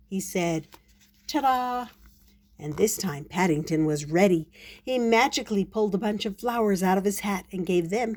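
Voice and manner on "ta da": high, triumphant reveal; pitched-up showy exclamation; magician's flourish. Acted. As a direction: present the finished trick with a flourish.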